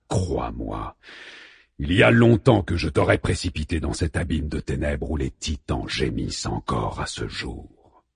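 low, menacing god's voice; voix grave de Zeus. A narrator's reading. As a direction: growl it down like an angry Zeus. Zeus sermonne Arès, voix grave. (voix divine grave et menaçante)

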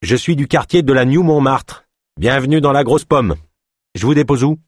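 high, hearty boatman's welcome; exclaiming. A narrator's reading. Boatman welcomes passengers to New York, exclaiming. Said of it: "s'exclama le batelier".

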